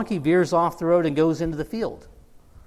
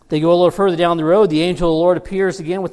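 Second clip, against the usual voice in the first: louder and fast, losing patience; exasperated buildup; 7 dB louder, faster than usual. Shown as a demonstration. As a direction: push it, louder and faster, fed up. Third time, exasperated retelling.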